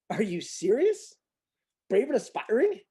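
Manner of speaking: can't believe what he just read; incredulous. Real, not acted. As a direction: scoff at the words in disbelief. Mocking the quoted phrase.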